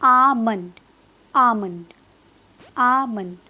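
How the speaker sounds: आमंड with a long aa and silent L; आमंड with the final D left almost unsaid; correct almond pronunciation. Shown as a demonstration. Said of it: "ड pronounce भी नहीं करना है".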